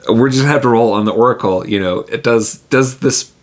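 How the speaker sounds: ordinary delivery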